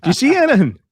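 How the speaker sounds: high, exaggerated questioning; mock neighbour question. Asking neighbours about the burglary, exaggerated mock question. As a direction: quiz the neighbour theatrically. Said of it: "they do this".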